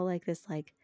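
ordinary talk, nothing marked

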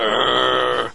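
drawn-out mock-angry grunt; angry growl. Host acting out throwing a rock at a bird, angry growl. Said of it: "Be like, a bit angry".

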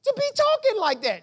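preacher voicing an elder scolding the young Jesus, high and indignant. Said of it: "Can you imagine the elders".

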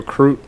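"croot" said with an r after the k; mock country "croot" instead of cute. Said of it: "country folks when they say cute, they go".

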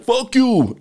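high mock Canadian accent. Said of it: "that didn't sound like their accent".

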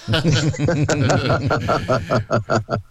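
loud open laugh; hearty laugh. Real, not acted. Laughs at own joke.

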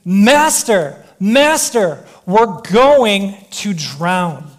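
loud, drawn-out cry of alarm; panicked scream. Acted. Disciples waking Jesus in the storm, screamed in panic. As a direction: cry out in panic, stretching the words. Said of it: "and they just screamed".

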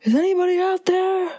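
high-pitched mock-desperate call; calling into emptiness. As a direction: call out as if nobody is listening. Imagining podcasting alone to no listeners.

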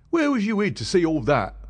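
high, loud frightened cry; a startled constable crying out in alarm; cried out. Acted. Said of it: "he cried".

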